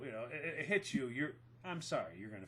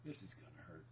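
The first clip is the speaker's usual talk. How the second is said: low, much softer muttered warning; quiet aside; 11 semitones lower, 17 dB quieter, faster than usual. Retold or imitated. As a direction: murmur it under your breath to her. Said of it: "looked at her and went".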